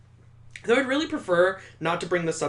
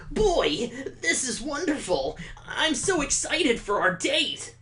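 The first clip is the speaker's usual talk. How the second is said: put-on higher, gushing eager boy voice; eager Victor; 9 semitones higher than usual. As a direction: play a giddy date who can't wait. Voicing Victor in the game.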